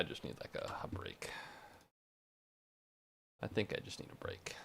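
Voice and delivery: whispered, worn-out and very soft; whispering. Real, not acted. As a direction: breathe it out like you're spent. Repeats it, worn out.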